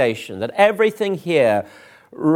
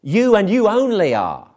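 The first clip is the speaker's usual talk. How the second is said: high, singling-out weight on "You" and "you only"; single out the listener on each "you"; pointed, deliberate "You and you only"; emphatic "You"; 4 semitones higher than usual. Shown as a demonstration. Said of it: "the effect of it is".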